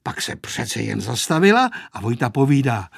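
plain reading voice

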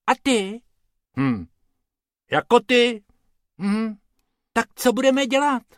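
sweet, eager little rabbit explaining; small rabbit talking politely. A narrator's reading.

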